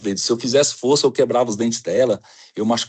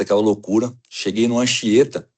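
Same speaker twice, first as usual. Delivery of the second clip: ordinary talk, nothing marked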